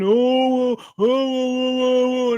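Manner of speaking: high, slow, drawn-out mock whimper of oh; mock whining. Mocking a rival YouTuber who complains all day, mock whining. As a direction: whine it out like a mocked crybaby. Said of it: "whine and complain".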